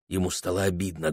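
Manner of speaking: usual speaking voice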